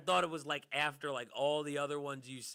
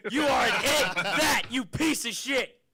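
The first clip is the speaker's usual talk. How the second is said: loud, high mock tirade; angry; 11 semitones higher, 8 dB louder, slower than usual. Comic joining in yelling at audience members, mock angry shout. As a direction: shout down a heckler in hammed-up fury. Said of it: "so angry about celebrities".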